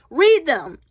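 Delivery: scolding the viewers to read; firm command. Real, not acted. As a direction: insist on it. Repeating the rule.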